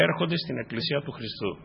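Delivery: ordinary delivery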